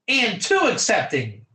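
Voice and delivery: normal talking voice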